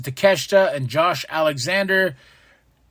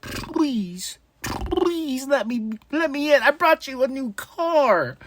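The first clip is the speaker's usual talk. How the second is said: higher, slow pleading whine; hammed-up begging, higher; mock begging MJF; 9 semitones higher, slower than usual. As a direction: beg like a desperate salesman. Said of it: "who's still like".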